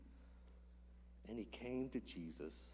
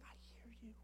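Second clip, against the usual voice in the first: soft unvoiced whisper as Jesus, higher; 9 semitones higher, 15 dB quieter, faster than usual. Pastor voicing Jesus to the broken, in a whisper. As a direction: breathe it gently like a promise.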